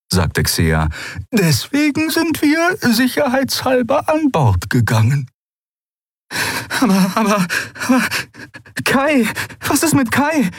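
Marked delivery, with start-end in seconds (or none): 1.3-5.3 very high-pitched character voice; friendly explanation. A narrator's reading. Friend explaining why the crew came aboard, high and matter-of-fact. 6.8-10.5 high, stammering, anxious question; flustered worry. A narrator's reading. Pilot suddenly worried about the man left out, flustered.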